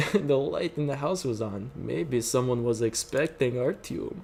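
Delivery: ordinary delivery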